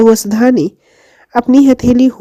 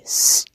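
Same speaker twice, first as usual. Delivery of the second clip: quiet hushing hiss; soft shush; 7 dB quieter than usual. A narrator's reading. Husband hushes his crying wife at night, a soft shush. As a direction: hush her gently so nobody wakes. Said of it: "ऋषि ने उसे चुप कराते हुए कहा".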